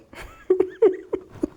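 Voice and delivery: high cackling laugh. Real, not acted. Host laughing at his own Texan impression, high laugh. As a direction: crack up hard. Laughs after Texas bit.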